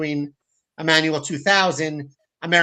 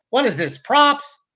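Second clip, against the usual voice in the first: slow, high, louder incredulous question; mock disbelief; 9 semitones higher, 5 dB louder, slower than usual. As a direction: draw it out, playing puzzled on camera. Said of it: "he catches it, goes".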